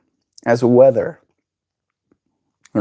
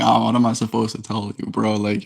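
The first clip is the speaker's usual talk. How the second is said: normal talking voice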